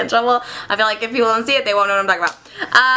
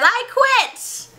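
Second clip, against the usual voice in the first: high, mock-defiant declaration; acted outburst; 11 semitones higher than usual. Accountant quitting his job, acted outburst. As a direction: blurt it high as a stage resignation. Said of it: "so he says".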